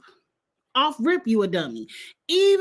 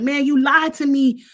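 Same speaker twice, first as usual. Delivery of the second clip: imitated accusation, higher pitch, tossed-out "dog"; Tucker; 4 semitones higher, slower than usual. Retelling a housemate confronting a liar, imitated accusation. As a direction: mimic a housemate throwing out an accusation. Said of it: "the way he was throwing it out there".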